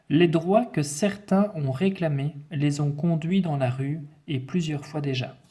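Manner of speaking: projected dictation voice; dictation reading. Acted. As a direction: read the sentence out to a class. Reads the dictation text aloud.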